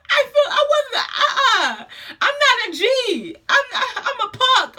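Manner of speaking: high, stumbling protest through laughter; whiny mock refusal, half laughing; comic panic. Retold pleading during labour, laughing.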